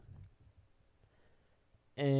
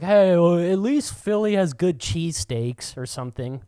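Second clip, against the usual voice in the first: louder, higher retort; defending his city; defensive comeback; 6 semitones higher, 7 dB louder than usual. Retold or imitated. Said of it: "the guy's like".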